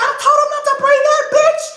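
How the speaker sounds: very high, loud, fast mock rant; retold rage. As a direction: race through a comic furious tirade. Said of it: "But I was so mad".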